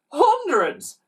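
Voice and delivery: high, loud incredulous scoff; scoffing villain. A narrator's reading.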